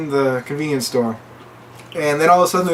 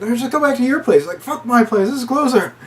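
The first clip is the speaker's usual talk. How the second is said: frantic arguing; 10 semitones higher than usual. Retold or imitated. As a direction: argue in a frantic rush. Arguing while fleeing.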